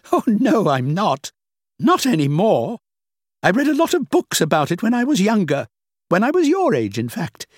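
a hint of a dismissive older woman; lightly played older woman, amused.

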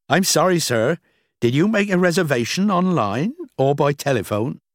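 high-pitched, courteous receptionist voice; polite hotel clerk. Acted. Clerk answering a shouting guest, polite high voice.

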